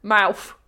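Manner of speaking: "Mouth" ending in an F sound. Shown as a demonstration. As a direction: say "Mouth" as "mouf". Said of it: "my T Hs are definitely gonna turn into Fs".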